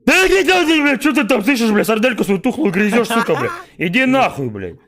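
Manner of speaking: loud furious yelling at a friend; angry yelling. Real, not acted. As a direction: shout it back, really annoyed. Angry outburst at friend's jab.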